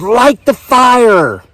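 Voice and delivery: high, drawn-out yell; long, high-pitched cry of command. A narrator's reading. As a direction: yell it out slowly like a frenzied order. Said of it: "and when she yelled".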